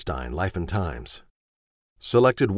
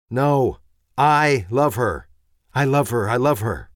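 put-on higher, louder simple boy insisting; a sweet boy voice, earnest; childlike Ben; 4 semitones higher, 4 dB louder than usual. Ben insisting to his father, higher and louder.

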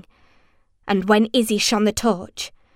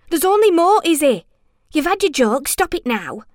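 raised, urgent scolding; higher, louder impatient outburst; exasperated; 7 semitones higher, 4 dB louder than usual. A narrator's reading. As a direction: tell them off, unnerved. Ewan scolding Izzy.

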